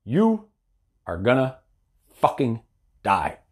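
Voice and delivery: hushed ominous warning. Real, not acted. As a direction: drop it low and let each word land like a verdict. Slow dramatic warning.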